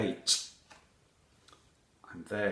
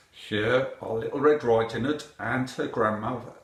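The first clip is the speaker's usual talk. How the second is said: ordinary delivery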